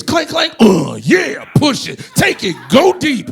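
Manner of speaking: loud, high, slow grunting gym bro; mock gym grunts, slow and high. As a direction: strain it out like a lifter. Imitating grunting gym sounds.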